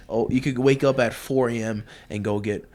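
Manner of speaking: ordinary talk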